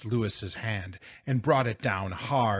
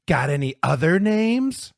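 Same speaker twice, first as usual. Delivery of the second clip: loud, high, rising jeer; careless taunt; 7 semitones higher, 8 dB louder than usual. A narrator's reading. Bully provoking the angry boy, carelessly taunting. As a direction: goad him with a loud mocking question. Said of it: "said Woody carelessly".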